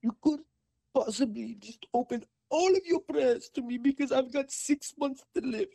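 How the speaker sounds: high, pitiful put-on voice, slowing at the end; mock dying lady. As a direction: play the customer asking for everything. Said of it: "She goes".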